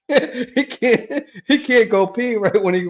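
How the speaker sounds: high, laughing speech. Real, not acted. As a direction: get the words out through real laughter. Still laughing at the pee joke.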